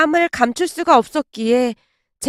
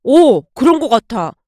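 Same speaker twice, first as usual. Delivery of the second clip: mildly amazed realization; husband struck by the coincidence; marveling; 4 dB louder than usual. A narrator's reading. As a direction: agree with a touch of wonder. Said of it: "남편이 신기한 듯 말을 하고".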